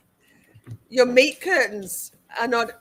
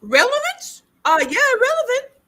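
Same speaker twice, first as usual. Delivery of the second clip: mock high objection; 13 semitones higher than usual. Mocking the objection from the sidelines.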